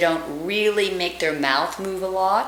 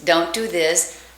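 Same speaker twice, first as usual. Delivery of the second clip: normal talking voice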